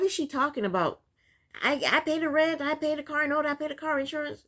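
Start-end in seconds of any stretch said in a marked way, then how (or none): 1.6-4.4 mocking her list. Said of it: "she's naming off everything that she has done".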